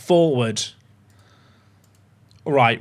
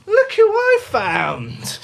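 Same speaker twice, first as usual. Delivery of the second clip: high, slow cooing pet talk; dog voice; 12 semitones higher, much slower than usual. Real, not acted. Streamer showing his dog Milo to chat, slow high coo.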